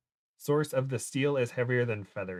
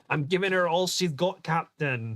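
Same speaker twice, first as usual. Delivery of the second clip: higher, hammed-up Scottish engineer accent; quick Scottish accent impression for chat; Scotty's Scottish accent; 6 semitones higher than usual. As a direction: call it to the captain in a Scottish accent. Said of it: "doing the voice for Scotty".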